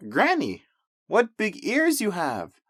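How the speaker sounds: put-on a wide-eyed little girl asking. Red questions Granny.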